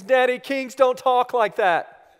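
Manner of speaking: put-on high, loud scolding child; little daughter. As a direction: imitate a small girl correcting her dad, slowly. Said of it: "And she says".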